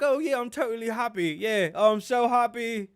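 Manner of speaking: hammed-up eager insistence, pitched high; Harry impression. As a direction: imitate a prince overselling his new life. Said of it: "He's like".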